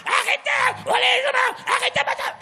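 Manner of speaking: high, loud angry yelling; shouting. Retold or imitated. A preacher mocks people who shout all the time, yelling. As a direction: yell like someone always screaming. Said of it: "des gens qui crient tout le temps".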